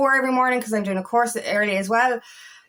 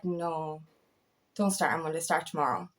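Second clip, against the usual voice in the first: quiet inner voice; 6 semitones lower, 9 dB quieter than usual. Retold or imitated. Inner voice correcting herself.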